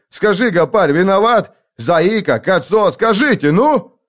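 loud, high, exasperated self-defence; agitated protest. A narrator's reading. Cornered survivor appealing to the gang, agitated protest.

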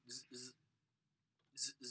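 soft vocal buzz; phone buzzing imitation. Phone vibrating by the bed at night, imitated buzz. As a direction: buzz quietly in short bursts like a phone.

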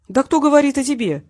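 surprised. A narrator's reading. Captor to his prisoner, taken aback. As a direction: toss the question back in disbelief. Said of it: "удивился Васильев".

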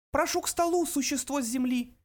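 very high, tiny creature voice; thin little voice. A narrator's reading. Mouse invites the earth creature to table, thin little voice. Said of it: "раздался тонкий голосок".

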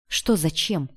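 ordinary delivery

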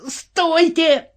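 very high, strained cry; yelling. A narrator's reading. Officer pushing into the crowd, yelling. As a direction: cry out to halt everyone. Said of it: "вопил Подтяжкин".

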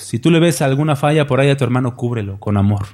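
usual speaking voice

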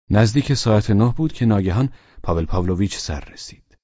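usual speaking voice

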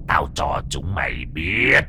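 low, slow menacing ghost voice; possessed girl. Acted. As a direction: drag each word out like a spirit. Possessed girl threatens everyone. (giọng vong ma trầm, chậm, đe dọa)

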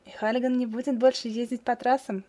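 usual speaking voice

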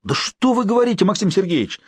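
put-on high, flustered old man; flustered old caretaker. A narrator's reading. Caretaker waving his arms at his boss, flustered high protest. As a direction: throw up your hands in protest.

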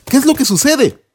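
put-on curious young shepherd; David asking the soldiers what is happening. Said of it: "David dijo".

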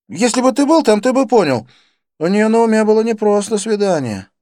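high, loud, insistent character voice; worked-up friend. A narrator's reading. Friend excusing himself over a date, worked up. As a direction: plead your case, pitched well up.

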